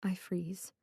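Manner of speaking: plain reading voice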